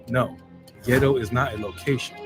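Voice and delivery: ordinary talk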